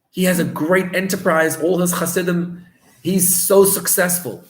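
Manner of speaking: bewildered wagon driver. Retold or imitated.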